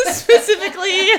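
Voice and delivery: put-on high, loud laughing Essex accent. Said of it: "don't hate me for doing the accent".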